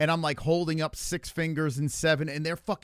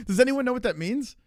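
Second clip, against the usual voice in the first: asks chat higher and louder; exasperated plea for an answer; 10 semitones higher, 4 dB louder than usual. Real, not acted. Streamer asks chat what six seven means, high and pleading.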